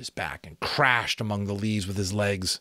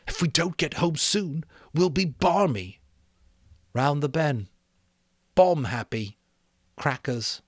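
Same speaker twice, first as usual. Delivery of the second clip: put-on a boy's voice, higher, fretting; anxious boy insisting in the dark; worried Piggy; 7 semitones higher, 3 dB louder than usual. A narrator's reading.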